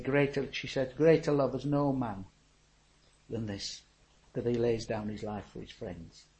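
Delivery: soft, hushed recitation fading; quiet reverent quote. Retold or imitated. Widow quietly quoting her husband's tombstone verse, soft. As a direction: say it gently, almost to yourself.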